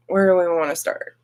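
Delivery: ordinary talk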